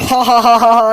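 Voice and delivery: loud, high cackling laugh; mocking laughter. Real, not acted. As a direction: laugh right at him.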